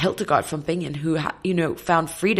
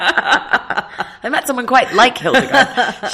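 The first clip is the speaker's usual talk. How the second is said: laughing through the line, higher and louder; 7 semitones higher, 9 dB louder, much slower than usual. Real, not acted. Answering the host's joke, laughing. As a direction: still giggling as she answers. Joking after laughing at mix-up.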